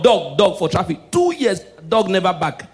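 ordinary delivery

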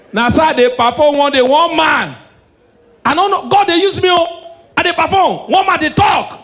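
high, loud heckling shout; mock heckler shout. Heckler yelling at the comedian, loud shout. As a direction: shout over the show like a heckler.

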